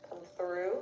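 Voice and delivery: normal talking voice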